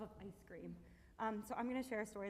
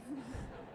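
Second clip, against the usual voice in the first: short laugh; 3 semitones higher than usual. Real, not acted. Laughs after telling Papa's punchline.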